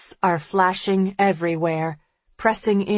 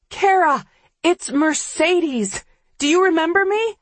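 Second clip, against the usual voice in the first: calling out; 10 semitones higher, 3 dB louder than usual. A narrator's reading. Calling out desperately.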